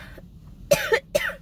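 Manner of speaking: harsh chesty cough from a cold; coughing fit. Real, not acted. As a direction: cough hard, sick with a cold. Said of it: "I'm coughing".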